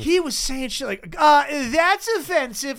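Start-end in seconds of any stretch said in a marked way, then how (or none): 1.2-2.8 high, drawn-out mock complaint; GameStop-energy heckler. Comic imitating an offended audience member, high slow mockery. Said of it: "had this fucking GameStop energy".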